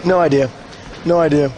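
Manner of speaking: normal talking voice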